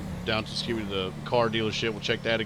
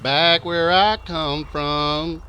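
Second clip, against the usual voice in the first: slow drawl; 3 semitones higher, 3 dB louder, much slower than usual. Pretend.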